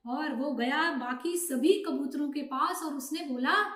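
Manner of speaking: plain reading voice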